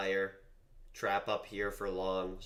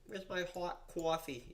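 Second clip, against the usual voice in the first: high, slow mock Boston whine; hammed-up Boston "hot coffee", drawn-out aw vowels; exaggerated Boston accent; 10 semitones higher, slower than usual. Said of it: "so blown out of proportion".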